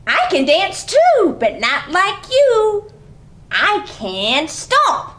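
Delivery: put-on bright read-aloud for small kids; lively story reading. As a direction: read it like a playful picture-book story time. Reading picture book aloud.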